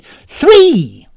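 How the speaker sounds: loud, high furious shout. A narrator's reading. Tanisan yelling her choice into the shell phone. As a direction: bellow the number. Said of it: "shouted Tanisan".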